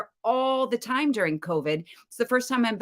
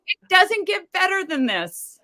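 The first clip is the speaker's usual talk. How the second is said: slow, high, mock grandeur; 12 semitones higher than usual. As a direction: ham up the glory. Joking about dream career.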